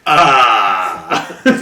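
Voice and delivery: loud, helpless laughter; laughing hard. Real, not acted. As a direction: crack up at the joke. Laughing fit at the table.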